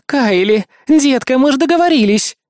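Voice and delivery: loud, high exasperated reproach; Helen's scolding bark. A narrator's reading. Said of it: "рявкнула появившаяся на крыльце Хелен".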